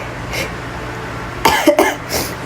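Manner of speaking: hard dry coughing fit. Real, not acted. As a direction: cough it out helplessly. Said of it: "I can't even talk".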